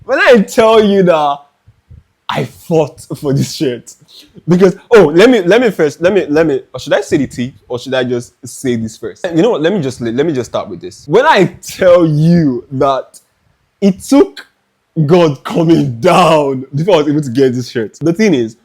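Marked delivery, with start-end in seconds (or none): 0.0-4.0 slow, high, drawn-out hype; dramatic drawn-out build-up. Acted. Vlogger teasing viewers about the shirt, slow and dramatic. 11.1-18.0 dramatic build-up. Acted.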